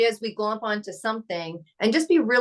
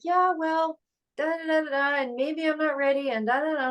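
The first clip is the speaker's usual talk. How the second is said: higher-pitched mock dithering, trailing into da-da-da filler; imitating someone's excuses; 6 semitones higher than usual. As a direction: mimic someone rattling off excuses in a raised voice. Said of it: "It was like".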